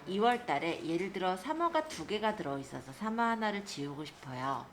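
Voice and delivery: usual speaking voice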